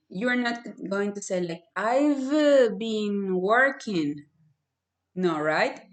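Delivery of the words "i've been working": robotic slow. Shown as a demonstration. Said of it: "That sounds like a robot".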